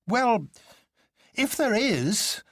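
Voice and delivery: high, impatient doctor voice; jolly doctor, high and impatient; impatient Bull. A narrator's reading. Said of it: "said Bull impatiently".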